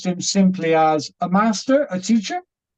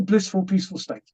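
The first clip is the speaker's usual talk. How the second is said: plain reading voice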